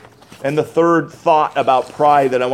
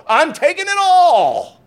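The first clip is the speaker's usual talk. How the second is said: high, slow, grasping boast; much higher, drawn-out proud claim; boastful king; 13 semitones higher, 4 dB louder, slower than usual. Retold or imitated. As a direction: crow it like a greedy conqueror. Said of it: "he felt".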